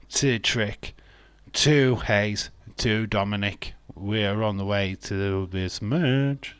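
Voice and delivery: ordinary talk, nothing marked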